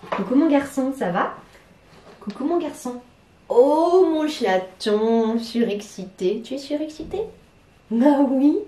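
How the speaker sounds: put-on baby talk to the cat. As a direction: coo at the kitten like a baby.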